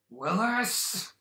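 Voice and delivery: loud, high drawn-out call. Acted. Player hollering for Willis, calling out. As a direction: holler a name into the distance. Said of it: "just hear his name kind of echo".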